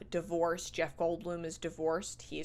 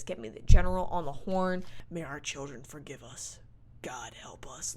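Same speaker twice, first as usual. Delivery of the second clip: Bill Pullman; 4 semitones lower, 5 dB louder than usual. Acted. Host quotes the movie president, lightly acted gravity. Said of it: "He says some classic president lines".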